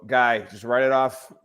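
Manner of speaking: ordinary delivery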